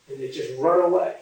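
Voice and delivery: ordinary talk, nothing marked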